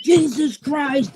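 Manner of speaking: high, slow exasperated groan of a name; exasperated father. Retold or imitated. Father on the sideline exasperated at his son's call, high and slow. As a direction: stretch it out like a fed-up dad. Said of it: "my father's like".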